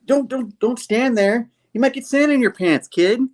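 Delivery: high, fussy nerd warning. Retold or imitated. The rescued nerd warns Spider-Man, in a high jittery voice. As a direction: warn him like a jittery nerd.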